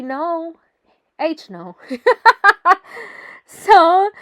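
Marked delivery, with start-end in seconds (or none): 1.8-3.1 bright burst of laughter; real laugh after refusing the trainer; high laugh. Real, not acted. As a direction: laugh at the idea of falling over.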